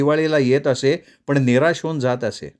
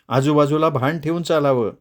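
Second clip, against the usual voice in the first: plain reading voice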